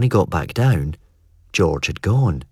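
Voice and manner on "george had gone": ordinary delivery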